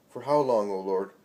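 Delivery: loud anguished cry to the Lord; crowd shouting. Acted. Mourners crying out loud to the Lord in grief. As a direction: call out loud in distress. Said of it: "shout many".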